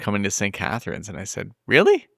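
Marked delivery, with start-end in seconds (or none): 1.7-2.1 high, rising incredulous question; mock disbelief. Host retelling reply about St. Catharines, incredulous. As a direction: pitch it way up, incredulous.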